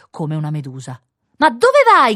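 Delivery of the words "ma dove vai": high, very loud call; shouted question. A narrator's reading. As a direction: yell after someone leaving. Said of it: "gridò il signore". (domanda gridata forte e acuta)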